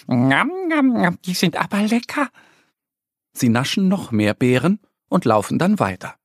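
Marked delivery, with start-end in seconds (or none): 0.1-2.3 high, delighted munching voice; smacking lips. A narrator's reading. Annie tastes wild strawberries, smacking with high delight. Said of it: "und schmatzt".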